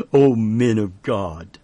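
ordinary delivery

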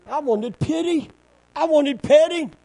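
self-pitying complaint. Acted. Elijah answering God, wanting pity, high complaint. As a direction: protest like someone wanting to be coddled.

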